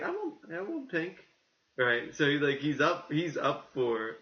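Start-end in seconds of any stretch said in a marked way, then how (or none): 0.0-1.2 pitched way up and softer, playfully pleading; mock high plea. Co-host imitating Channing Tatum's costume wish, high and soft. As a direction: ham up a squeaky wish for pink.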